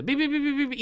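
beeps jumping up high; bat sonar closing in. Retold or imitated. Bat closing on a bug, imitated high-pitched sonar beeps. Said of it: "eats the bug".

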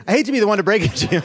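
talking through giggles; can't keep a straight face; laughing. Real, not acted. Breaking bad news, laughing.

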